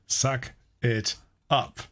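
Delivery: very slow, each word set apart in SUCK IT UP; pointed repeat. Acted. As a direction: repeat "Suck it up" slowly, word by word, for someone who missed it. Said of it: "What did you say again?".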